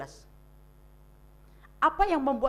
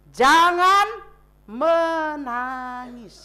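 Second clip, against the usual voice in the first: very slow, drawn-out comfort; tender comfort; 4 dB louder, much slower than usual. Acted. Jesus comforts the widow. (lembut dan sangat pelan menghibur)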